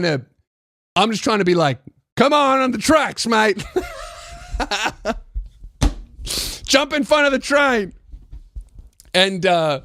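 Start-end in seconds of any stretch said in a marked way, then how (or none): 2.2-3.6 high-pitched goading call; mock taunting yell. Acting out taunting man across platform. 4.3-6.6 quiet, airy chuckle; real laugh. Real, not acted. Laughing at his own taunt. 6.7-7.9 high, goading call-out; mock taunting yell. Acting out taunt across platform.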